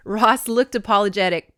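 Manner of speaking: plain reading voice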